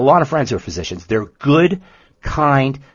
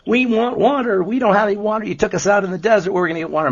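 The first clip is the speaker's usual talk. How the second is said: higher, whining demand for water; whiny demanding people; 8 semitones higher, faster than usual. Retold or imitated. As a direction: Israelites nagging Moses. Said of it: "the children of Israel say".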